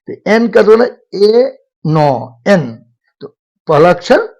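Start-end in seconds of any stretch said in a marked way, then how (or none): 1.2-2.7 "ए नो एन" slow, the letter N split into e and no, then joined; sounding out. Shown as a demonstration. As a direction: break "एन" into its sounds, vowel first. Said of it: "माने ए से उच्चारण होता है".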